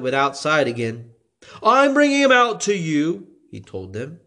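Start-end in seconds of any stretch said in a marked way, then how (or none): 1.6-3.3 a lightly raised governor's voice; Pilate voiced. A narrator's reading. Pilate to the crowd, lightly voiced and higher. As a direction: Pilate announcing, a bit higher.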